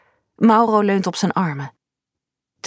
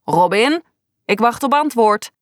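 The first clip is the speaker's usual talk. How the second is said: a touch of sharp teacherly impatience; mildly cutting voice; 3 semitones higher than usual. A narrator's reading. Teacher to pupil Robin, waiting for an answer, mildly cutting. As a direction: demand an answer, with slight edge. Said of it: "hoor ik zijn snerpende stem".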